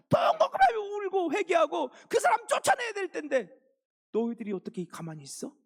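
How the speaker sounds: loud, high, near-crying rebuke; Paul scolding believers who tolerated sin, voice cracking; fierce scolding. Acted. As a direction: pour out grief and anger at the church. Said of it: "얼마나 야단치는지 몰라요".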